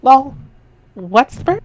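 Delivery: put-on perky girl reading her chat lines up high; Jade's chat voice.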